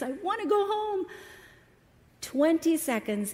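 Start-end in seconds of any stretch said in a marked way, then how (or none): 0.0-1.1 put-on high, pleading cry of a distressed boy; crying child. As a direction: cry it out like an overwhelmed little boy. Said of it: "Put his hands over his ears".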